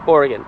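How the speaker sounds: "Oregon" as OR-ih-gun, falling; correct local pronunciation. Shown as a demonstration. Vlogger driving home his state's name, "Oregon" ending in a weak gun. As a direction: say "Oregon" with a short, unstressed last syllable. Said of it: "this word here is pronounced".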